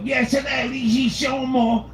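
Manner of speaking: hammy English assistant voice; English accent. Retold or imitated. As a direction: prank caller posing as a British assistant. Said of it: "that's the only accent I can barely do".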